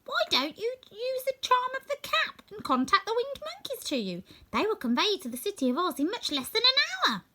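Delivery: a tiny queen's gracious reply; squeaky mouse queen. Acted. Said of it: "her squeaky little voice".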